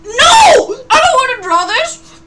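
panicked high shout of refusal. Real, not acted. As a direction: yell it out in real protest. Refuses to draw the prompt.

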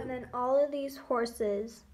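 ordinary delivery

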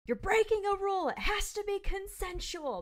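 loud, high-pitched action-movie one-liner; mock heroic scolding. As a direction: deliver it as a triumphant rebuke. Quoting film heroine's punchline.

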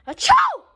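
sudden high loud fake sneeze. Fake sneezing to wake his sleeping sister. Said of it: "fake sneeze".

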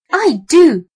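high squeaky rat voice. A narrator's reading. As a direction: answer like a little rat. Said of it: "answered the brown rat".

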